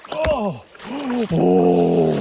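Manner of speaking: shocked cry. Real, not acted. Icy water gasp.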